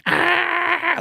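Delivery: very high mock scream of a little guy; tiny guy screaming. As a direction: squeal like a tiny angry man. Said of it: "a little guy screaming".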